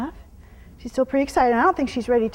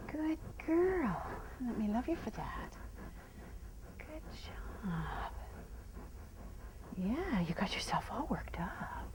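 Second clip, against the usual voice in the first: soft, slow whisper to settle her; soothing whisper; 3 semitones lower, 15 dB quieter, much slower than usual. Real, not acted. Trainer calming her worked-up horse, soft slow whisper. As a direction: hush her down gently.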